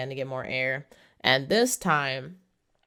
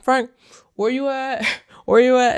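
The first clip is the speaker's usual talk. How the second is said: high, louder call for Frank, half laughing; searching yell, played for laughs; mock calling out; 8 semitones higher, 7 dB louder than usual. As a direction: call out for him across the water, hammed-up.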